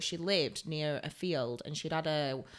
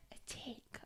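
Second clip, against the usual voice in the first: very soft whisper; hushed confession; 21 dB quieter than usual. Retold or imitated. Client admitting a tick to the beautician, hushed. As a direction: whisper it like an awkward admission.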